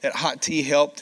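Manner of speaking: usual speaking voice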